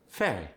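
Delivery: "Very" with the V softened toward F. Shown as a demonstration. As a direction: start "Very" near an F sound. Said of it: "V sound being pronounced as an F".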